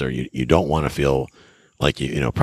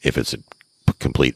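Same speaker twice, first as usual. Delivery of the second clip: ordinary delivery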